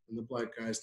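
usual speaking voice

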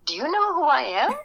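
high-pitched, astonished mimicked question; surprised retelling. Film star to a young fan who recognised him, surprised. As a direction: imitate someone amazed at being recognised.

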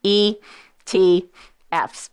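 E, T, Fs one at a time, with pauses; said slowly. Shown as a demonstration. Host spelling out a finance term for listeners, slowly. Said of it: "Let me say it slow".

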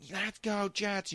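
high, drawn-out mocking woman's cheer; annoying fan voice. Friend mimicking a drunk Jets fan's chant, annoying voice. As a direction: imitate an irritating fan, slow and high-pitched. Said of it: "she had this really annoying voice".

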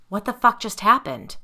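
loud, sharp demand; husband confronting Maurice, angry. A narrator's reading. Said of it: "Tyler demanded".

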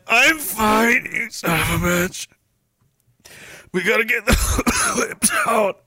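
high, hammed-up strained snapping; mock someone barely holding it together; strained denial. Acts out bro insisting he's fine.

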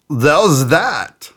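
very high, hammed-up French accent. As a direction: apologize like a French-accented game show host. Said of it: "when I do French accents".

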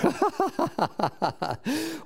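long high giggling laugh; high giggle. Real, not acted. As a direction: giggle high at his own joke. Laughs at his joke.

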